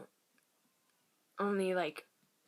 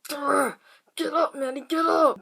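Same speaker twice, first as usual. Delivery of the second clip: growled command; 6 semitones higher, 9 dB louder than usual. Retold or imitated. Demon voice opening the story.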